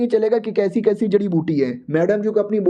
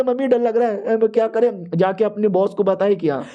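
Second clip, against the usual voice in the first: ordinary delivery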